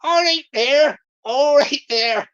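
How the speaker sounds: loud mock Bristol greeting; hammed-up West Country Bristol voice; Bristol accent. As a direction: put on a broad Bristol accent. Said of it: "he'll be talking like that, won't he".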